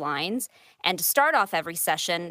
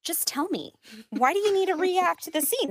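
imitating husband; 9 semitones higher than usual. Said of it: "He's like".

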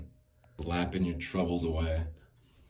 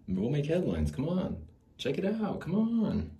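eager pitch. Retold or imitated. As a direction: hype him up, climbing in pitch as you beg. Pitching idea to Vince Offer.

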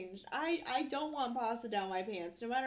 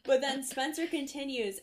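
ordinary delivery

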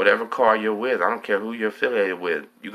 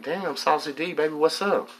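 excited greeting; 8 semitones higher than usual. Retold or imitated.

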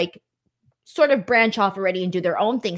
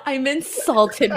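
mock insulted; 3 semitones higher, much slower than usual. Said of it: "mock offense, slow and drawn".

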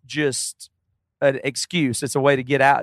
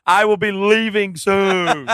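slow, higher mock-dramatic farewell; dramatic wife; 5 semitones higher, much slower than usual. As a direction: drag it out like a grand exit. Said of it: "Women love to threaten".

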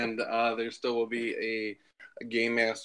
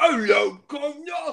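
posh-sounding British greeting, pitched up; mock British accent; 13 semitones higher, 6 dB louder than usual. Friend imitating British guy at Target, mock British accent. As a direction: put on a cheesy British accent. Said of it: "stupid accents at Target".

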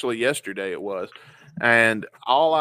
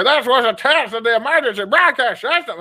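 much higher, loud announcer shout; emergency broadcast voice; 11 semitones higher, 9 dB louder than usual. Retold or imitated. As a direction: belt out the alert recording. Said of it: "and I hear".

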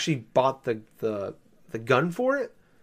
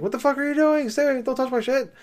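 high, mock-furious squawk at the intruder; angry glob guy; 12 semitones higher, 4 dB louder than usual. Glob guy yelling at the player for taking the seed, high mock fury. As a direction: play a mad forest creature chasing you off, pitched way up. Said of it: "he's mad at you".

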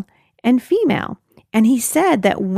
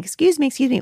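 imitated calling out; 7 semitones higher, faster than usual. Somebody hailing her as she leaves the car, lightly imitated calling out. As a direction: lightly mimic someone seeking attention. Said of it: "I can hear somebody saying".

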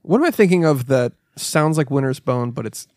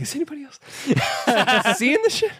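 amazed disbelief; 10 semitones higher, faster than usual. Retold or imitated. Retold amazed thought in theater.

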